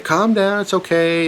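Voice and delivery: mock-soothing dad voice; calm dad. Dad telling his son to calm down, lightly mimicked. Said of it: "my dad's like".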